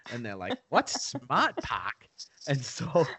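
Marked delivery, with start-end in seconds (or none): 0.7-2.1 put-on slow, drawn-out Boston accent with a laugh; thick Boston accent. Pals question the driver, thick Boston accent. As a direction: puzzle over it in broad Bostonian. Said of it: "like, a thick Boston accent".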